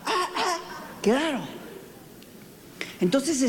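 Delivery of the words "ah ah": mock barking. Preacher imitating a little dog barking at mirrors. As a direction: bark lightly like a small dog. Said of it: "todos le ladraban".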